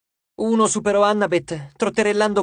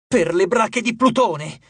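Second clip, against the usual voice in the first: cursing; 3 semitones higher, slower than usual. A narrator's reading. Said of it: "imprecò Reina".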